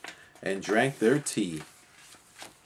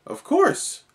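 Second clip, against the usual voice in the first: eager; 5 dB louder than usual. A narrator's reading. Eager reply to a friend.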